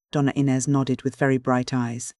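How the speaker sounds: ordinary delivery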